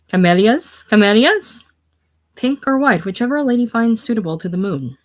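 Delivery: loud, high street vendor's call; crying out. Acted. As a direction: hawk flowers with rising, singsong cries. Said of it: "cries out".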